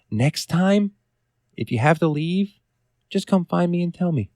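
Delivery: high-pitched imitation of a concerned coworker; worried Miss Edie. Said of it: "Miss Edie came up to me today and she's like".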